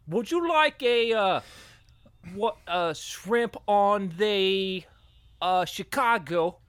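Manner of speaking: put-on high, halting Australian accent; slow, exaggerated Australian accent; Nicole Kidman. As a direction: fumble along in a broad, high Aussie voice. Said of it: "I'm Nicole Kidman".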